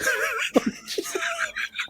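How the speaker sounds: very high burst of laughter; big shrieking laugh. Real, not acted. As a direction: explode into laughter.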